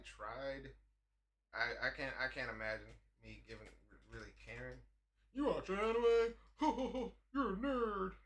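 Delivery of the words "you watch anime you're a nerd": high, drawn-out mocking kid's voice with a laugh; mocking taunt. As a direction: sneer it like a schoolyard bully. Mocking kids teasing him.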